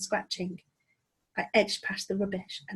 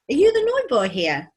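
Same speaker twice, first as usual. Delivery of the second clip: put-on high, loud girl calling; girl's voice; 7 semitones higher, 8 dB louder than usual. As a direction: call over the wall to a new boy. Girl calls over the wall.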